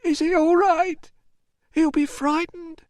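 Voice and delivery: high, slow, upset voice; shuddering worry. A narrator's reading. Mother asking after her taken son, distressed. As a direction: manage the words through distress. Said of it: "Chloe shuddered, then managed to say".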